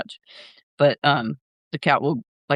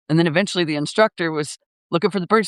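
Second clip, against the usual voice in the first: ordinary talk, nothing marked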